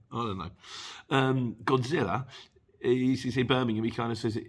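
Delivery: ordinary talk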